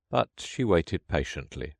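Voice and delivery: ordinary delivery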